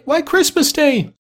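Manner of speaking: put-on very high, drawn-out boyish cry; boy's voice. A narrator's reading. Boy telling Scrooge the day, high boyish voice. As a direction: pipe it slowly as an astonished boy. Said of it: "replied the boy".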